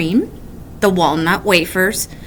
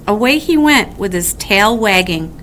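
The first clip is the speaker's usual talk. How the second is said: plain reading voice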